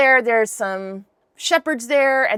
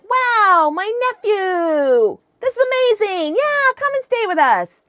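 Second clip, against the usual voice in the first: high-pitched, gushing uncle's greeting; hammed-up joy, stretching the words high; delighted welcome; 9 semitones higher than usual. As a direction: imitate an uncle overjoyed at a visitor. Uncle greets newly arrived nephew.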